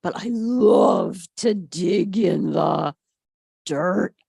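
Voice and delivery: slow, loud, low eager voice; drawn out puppy longing; longing to dig. A narrator's reading. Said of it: "George thought".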